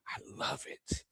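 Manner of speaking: very soft whisper; hushed relish. Real, not acted. Host relishing a fan's prediction, whispering. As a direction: whisper it, savouring the words.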